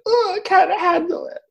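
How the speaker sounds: very high-pitched mock panic; overwhelmed squeal. Ghost finally given attention, overwhelmed squeal. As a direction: squeal as if it is all too much.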